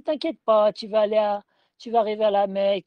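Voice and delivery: reassuring driver, said loud; chauffeur rassurant. Retold or imitated. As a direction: sell the driver's breezy reassurance loudly. Chauffeur rassurant, récit retold. (le chauffeur rassure le pèlerin, d'une voix forte)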